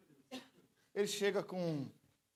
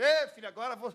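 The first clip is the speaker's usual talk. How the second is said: loud, excited shouting; excited loud friend; 5 semitones higher, 9 dB louder than usual. Retold or imitated. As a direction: call out to him, thrilled. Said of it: "ele me chama de filho". (amigo gritando empolgado)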